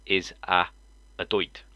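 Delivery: "doit" read literally, rhyming with "quoit"; pause, then say "doit" as misspelled; deadpan misspelling readout. Shown as a demonstration. Reading out the misspelt insult, "doit" said literally. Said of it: "if you read this correctly, it says".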